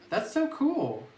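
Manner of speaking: ordinary talk, nothing marked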